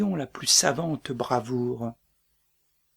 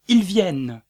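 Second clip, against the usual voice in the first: a sudden loud, higher cry of alarm; shouted cry; 5 semitones higher, 8 dB louder than usual. Acted. Macbeth mimics the guards' shout of alarm, loud and sharp. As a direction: fling out the sentries' warning.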